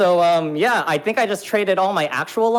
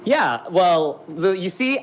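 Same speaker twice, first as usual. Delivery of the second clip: ordinary talk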